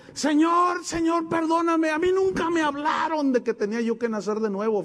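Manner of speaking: a pastor begging God to forgive him, voice lifted high; crying plea. Retold or imitated. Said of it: "llegó con sus manos levantadas llorando".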